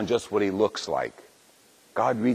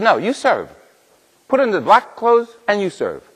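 put-on Italian priest accent; 11 semitones higher, 6 dB louder than usual. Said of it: "he spoke with a little bit of an accent".

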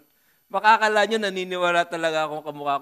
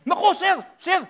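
much higher, panicked cry; alarmed waiter; 13 semitones higher, 8 dB louder than usual. Retold or imitated. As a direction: squeal like a startled waiter.

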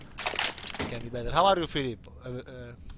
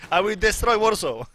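laughing boast; 5 semitones higher, 5 dB louder than usual. Real, not acted. Laughing boast about fireworks party.

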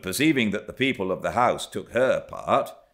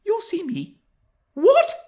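put-on very high girlish disbelief; surprised; 7 dB louder than usual. A narrator's reading. Young Cathy shocked at Hareton's answer, high surprised question. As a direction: jump up high and loud on the last word. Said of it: "asked Catherine in surprise".

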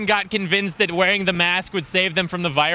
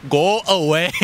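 put-on Canadian accent; slower than usual. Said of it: "she had big Canadian accent. She was like".

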